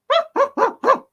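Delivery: squeaky high put-on giggle; high dog laugh. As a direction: giggle like the puppy.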